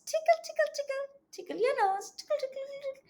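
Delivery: high, soft sing-song "tickle"; playful tickling. Acted. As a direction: coo it high and light, like tickling a baby. Tickling the dragon's nose.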